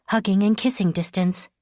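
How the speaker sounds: plain reading voice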